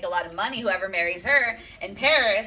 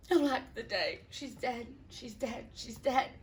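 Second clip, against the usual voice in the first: cries dying down to a much softer voice; fading grief; 6 semitones higher, 5 dB quieter than usual. Acted. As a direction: let the shouting collapse into grief.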